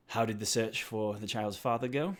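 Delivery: guard's question. Acted. Guard NPC asks Pim, voiced.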